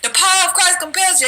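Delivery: high, ringing command to cast out a demon; exorcist command. Retold or imitated. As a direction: order the devil out. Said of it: "someone casting out the devil".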